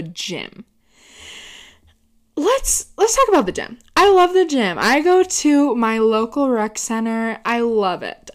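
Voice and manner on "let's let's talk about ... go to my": high bright launch into the topic; excited. Real, not acted. Vlogger kicking off her gym story, excited. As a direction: announce the topic with a big lift.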